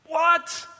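high, astonished one-word reaction; acted disbelief. Preacher reacting to the gift of the kingdom, mock astonishment. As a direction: react as if staggered by the news.

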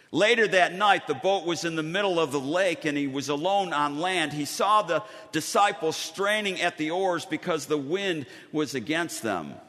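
ordinary talk, nothing marked

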